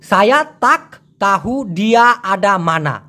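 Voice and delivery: slow "সায়া তাক তাহু ডিয়া আডা মানা", sounded k in "তাক"; textbook pronunciation. Shown as a demonstration. Teacher showing how it should have been, textbook pronunciation. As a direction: separate each word, full k in "তাক". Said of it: "এরকমটা হওয়ার কথা ছিল".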